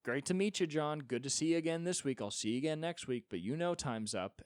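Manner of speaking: high, brisk, businesslike send-off; brisk old-school hooker. Retold or imitated. Eighties sex worker dismissing her client John, high and brisk. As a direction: show the client the door cheerfully. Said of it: "they had the wherewithal to be like".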